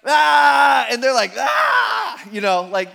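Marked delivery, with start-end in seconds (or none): 0.0-0.9 loud, higher charging yell; full-voiced madman's roar; wild yell. Naked man charges screaming. 1.4-2.2 loud, very high panicked scream; frightened scream. Retold or imitated. Disciples scream back in fright.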